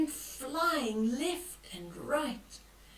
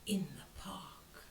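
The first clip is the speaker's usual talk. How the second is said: whispered, much softer and lower; spooky whisper; 8 semitones lower, 11 dB quieter than usual. A narrator's reading. Granny scaring children gently at the book's twist, whispered. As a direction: breathe it like a secret.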